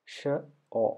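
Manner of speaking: ഷ, pause, ഓ as two separate sounds. Shown as a demonstration. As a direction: sound out ഷ and then ഓ with a gap between. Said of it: "ഷ plus ഓ ആണ് short".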